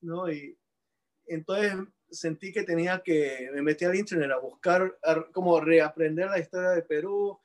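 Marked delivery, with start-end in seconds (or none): none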